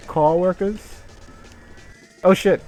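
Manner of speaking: ordinary delivery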